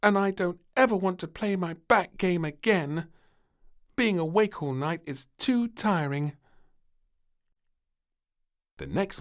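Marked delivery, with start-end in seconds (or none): 0.0-6.4 put-on high, louder tired child; despairing.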